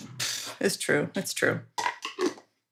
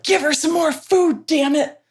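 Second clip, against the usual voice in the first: high, loud mock order; mock pushy dog demand; 9 semitones higher, 5 dB louder than usual. Starsky the dog demanding more food for her, high mock order. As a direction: boss the owner around as the greedy dog. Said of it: "Starsky's like".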